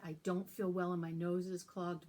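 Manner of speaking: usual speaking voice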